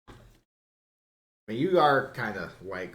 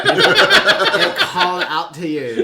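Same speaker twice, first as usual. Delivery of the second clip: high, loud, slow laughing tease; laughing mock; 10 semitones higher, 15 dB louder, slower than usual. Real, not acted. Teasing a friend about the glass, laughing high.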